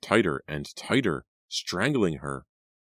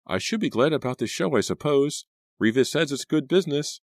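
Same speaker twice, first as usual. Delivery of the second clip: Pam's voice pitched higher and a bit louder; uneasy higher voice; 11 semitones higher, 4 dB louder than usual. Acted. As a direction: voice Pam higher, uneasy but trying to reason. Pam uneasy about the show.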